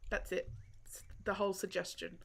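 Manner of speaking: hushed, conspiratorial whisper; soft whispered afterthought; whispered aside. Real, not acted. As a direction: whisper it like a secret jab. Whispered aside about the game.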